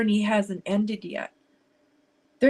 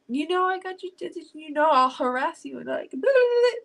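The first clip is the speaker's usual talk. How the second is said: tearful, higher and cracking; crying; 6 semitones higher than usual. Real, not acted. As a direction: voice breaking high into tears. Comforting crying viewer Jaxie.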